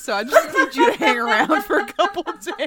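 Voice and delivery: loud, high, cracking up; real laughter taking over the words; laughing while talking. Real, not acted. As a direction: burst into laughter mid-sentence. Cracks up during acted excuse.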